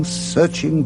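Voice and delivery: ordinary talk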